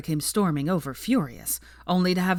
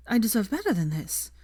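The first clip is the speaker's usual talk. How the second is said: startled; 5 semitones higher than usual. A narrator's reading. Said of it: "she said, rather startled".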